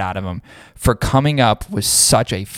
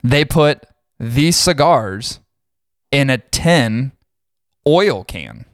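slow, spelled-out disgust; 2 semitones higher, much slower than usual. Real, not acted. Host repeating the tin oil can slowly in disgust. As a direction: drag each word out in disbelief.

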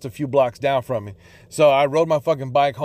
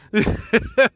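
short high laugh at his own rant; real laugh; 7 semitones higher than usual. Real, not acted. Laughing at his own imagined outburst at the ex, brief real laugh. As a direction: breaks into a quick laugh. Laughs after pretend scream.

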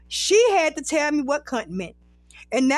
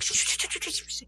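soft, whispery run of shushes; hushed shushing; 8 dB quieter, faster than usual. Retold or imitated. Best friend silencing the question at the airport, hushed. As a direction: shush repeatedly under the breath, imitating. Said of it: "Girl, be quiet".

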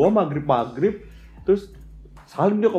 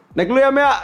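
chirpy, much higher and louder; high chirpy goodbye; 10 semitones higher, 4 dB louder than usual. Retold or imitated. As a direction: sing out a cheerful goodbye. Friend says goodbye, high.

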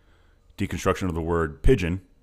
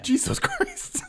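laughing; much slower than usual. Real, not acted. Reacting to seven percent alcohol.

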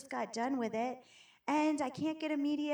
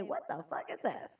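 confused friend; 7 semitones lower, 3 dB quieter than usual. Retold or imitated. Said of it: "they'll say".

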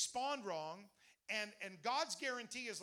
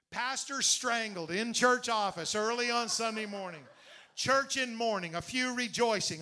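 mock headline; 8 dB louder than usual. Mock headline read aloud.

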